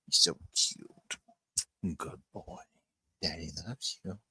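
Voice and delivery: slow soft whisper. Real, not acted. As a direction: breathe it tenderly to the puppy, slowly. Whispering to the puppy.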